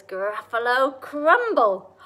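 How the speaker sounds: slow, loud GRUFFALO CRUMBLE; relished threat. Acted. Mouse names a favourite food to the Gruffalo, slow relished threat.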